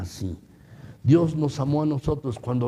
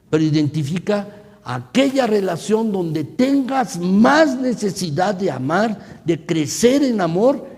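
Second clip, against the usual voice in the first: higher, louder, slow urging; fiery preaching; 6 semitones higher, 5 dB louder than usual. Acted. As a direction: press the church to act, full force. Preaching at full force.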